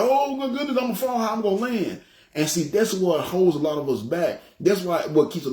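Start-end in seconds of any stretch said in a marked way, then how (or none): 0.0-2.0 mock panic. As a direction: squeak it out like you're falling from the sky. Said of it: "I'm like".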